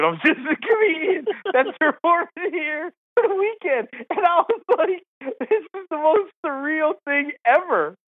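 high, laughing through the words; high laughing amazement; laughing disbelief. Real, not acted. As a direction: giggle in amazement at it all. Laughing disbelief at surreal moment.

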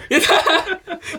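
high, drawn-out whoop with laughter; real cheer. Real, not acted. 正解して大喜びする. (笑いながら甲高く歓声)

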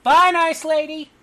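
put-on very high, loud childlike farewell; loud, piping cute goodbye; small cat boy calling out. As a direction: call goodbye in a squeaky little-creature voice.

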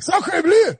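high mock French exclamation; French accent. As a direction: ham up a cartoon Frenchman. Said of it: "Like, uh, like".

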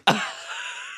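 airy silent giggle; a wheezy breathy laugh; breathless laugh. Real, not acted. Laugh after a joke.

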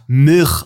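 Milch without its L, as "Mirch"; mispronounced Milch. Retold or imitated. Podcaster mimicking an ex-colleague's error, mispronounced Milch. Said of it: "der hat Milch einfach Milch ausgesprochen".

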